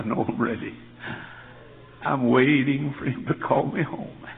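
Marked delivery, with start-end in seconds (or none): 2.1-4.3 loud, chanted revival appeal; altar call. Acted. Pastor urging young people forward, loud chanted appeal. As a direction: call them down to the altar, full voice.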